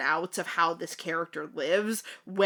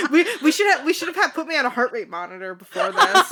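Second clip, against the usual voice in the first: high, loud, flustered laughing speech; 9 semitones higher, 7 dB louder, faster than usual. Real, not acted. Co-host flustered by the fanfic voice, laughing helplessly. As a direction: stammer it out through big laughs.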